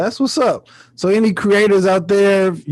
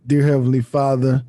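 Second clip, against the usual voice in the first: prayer; 6 semitones lower than usual. Pretend. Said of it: "I ain't doing prayers".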